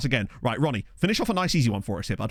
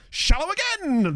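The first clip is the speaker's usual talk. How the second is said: excited; 14 semitones higher, 3 dB louder than usual. Real, not acted. Goal chance, commentator excitement.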